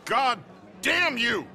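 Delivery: loud, high outburst at the loss; angry curse. Acted. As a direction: spit it out at the man who beat you. Loser curses after losing hand.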